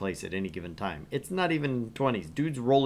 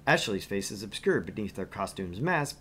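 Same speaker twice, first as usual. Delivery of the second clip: usual speaking voice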